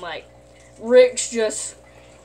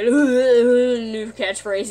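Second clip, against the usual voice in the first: very slow, stretched, louder; dragged-out drawl; 7 dB louder, much slower than usual. Retold or imitated. Kid mimicking Rick's new catchphrase, slow and drawn out. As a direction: drag each word out lazily.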